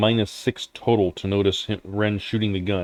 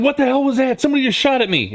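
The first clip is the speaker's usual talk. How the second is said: startled outburst; 13 semitones higher, faster than usual. Acted. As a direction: yelp in shock at the gunshot. GM voices NPC shot at.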